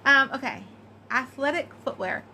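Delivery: usual speaking voice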